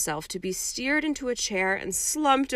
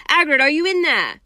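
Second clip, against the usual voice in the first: louder, higher, quick call; 6 semitones higher, 8 dB louder, faster than usual. A narrator's reading. Harry thumps the door and calls Hagrid, loud. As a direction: shout through the door. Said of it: "called Harry".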